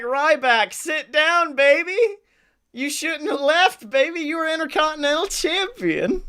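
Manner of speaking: high-pitched acted telling-off; mock scolding. Hosts talk down a crying wrestler, in mock scolding. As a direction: chide him, lifting into each baby.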